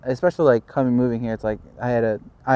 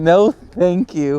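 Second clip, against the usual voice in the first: slow emphatic refusal; 6 semitones higher, 7 dB louder, much slower than usual. Real, not acted. Refusing the frozen Yukon.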